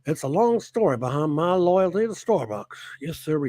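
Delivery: lightly voiced American cowboy; mock cowboy drawl. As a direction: play a stetson-wearing Texan reading his message. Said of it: "He must talk like".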